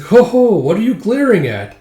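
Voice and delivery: high, loud gloating; hammed-up villain jeer; mocking villain. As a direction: taunt the captive after a stagey chuckle. Villain mocks captive.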